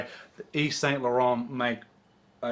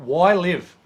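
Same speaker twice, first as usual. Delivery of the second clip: insisting on the name; 4 semitones higher, 9 dB louder than usual. Real, not acted. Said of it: "I think it's Y Live".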